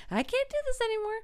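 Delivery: high, fast mock despair; mock breakdown. Repeated, rising breakdown.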